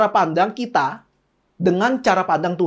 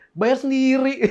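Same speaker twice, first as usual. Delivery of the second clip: mock blunt bill; 5 semitones higher, slower than usual. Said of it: "pas selesai makan".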